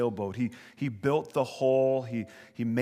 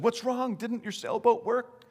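lightly voiced mother, much higher; mother's voice; 10 semitones higher than usual. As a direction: ask like a concerned mom, pitched up. Mother asks crying boy.

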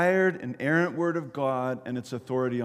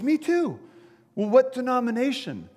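high, eager acted shout; excited calling; 9 semitones higher, 5 dB louder than usual. Bystander quizzing jumper on denomination, excited calling. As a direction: pounce on the shared ground, pitched up.

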